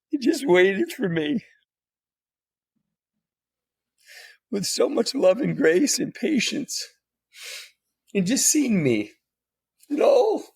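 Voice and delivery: high, halting, tearful gratitude; choked up. Real, not acted. Honouring the women who waited for him, choked up. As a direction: push through real emotion, pausing between phrases.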